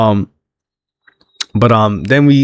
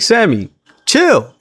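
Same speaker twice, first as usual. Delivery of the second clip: mock exasperation; 9 semitones higher, slower than usual. Voicing Damian telling Sammy to calm down.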